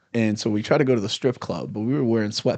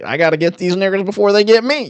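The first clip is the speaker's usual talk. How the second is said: loud, high-pitched caricature of a cop; hammed-up paranoid lawman; Uncle Ruckus-like cop; 10 semitones higher, 7 dB louder than usual. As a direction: imitate a cop ranting in alarm. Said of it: "like the Uncle Ruckus of cops".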